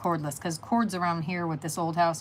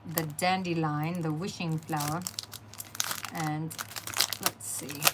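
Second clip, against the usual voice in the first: crunching chips; 2 semitones lower, 5 dB quieter than usual. Real, not acted. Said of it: "Sorry about the crunchy stuff".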